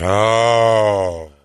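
long, drawn-out "oh"; imitated audience groan. Audience reacting to [name]'s speech, imitated groan. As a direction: groan like a whole crowd at once. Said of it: "there was audible groans".

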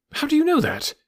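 sharp high indignant question; indignant retort. Acted. Watson refusing to be left behind, high indignant retort. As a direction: fire it back, bristling. Watson insisting on coming along.

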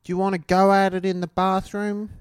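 high bogan Australian; bogan chick accent. Acted. Co-host joining the bogan bit, high Australian. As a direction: proposition like an Australian bogan woman. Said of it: "Bogan chicks have the hottest accent".

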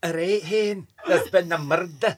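mock Glasgow detective voice; deadpan Scottish cop line; Scottish accent. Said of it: "Can you do a Scottish accent?".

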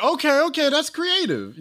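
high, amused fake praise; mock impressed. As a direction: sound impressed, half joking. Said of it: "mock impressed reaction".

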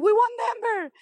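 high, thrilled retold cry; excited shout. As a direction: announce the win, bursting with joy. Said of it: "I'm like".

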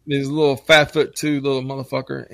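ordinary delivery

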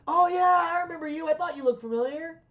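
high-pitched, lit-up greeting, retold; delighted recognition. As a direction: imitate someone lighting up as he recognizes a fan. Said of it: "his eyes lit up and he went".

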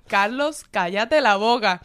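loud, pleading and laughing through it; urging him to drop it, half laughing; hushing a friend. Retold or imitated. Telling drunk Carlos loudly to calm down, laughing. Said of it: "yo estaba al frente de Carlos así".

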